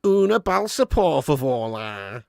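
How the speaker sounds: mock Scouser abroad in Spain; Scouse Spanish. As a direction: ask politely in broad Scouse Spanish. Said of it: "Scouser's accent in a Spaniard".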